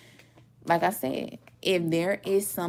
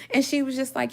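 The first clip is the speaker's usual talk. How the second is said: ordinary talk, nothing marked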